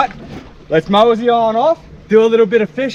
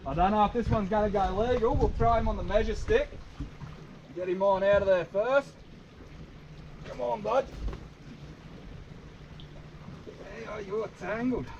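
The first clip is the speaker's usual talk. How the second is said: hushed; 16 dB quieter than usual. Real, not acted. Quietly handling tangled crab.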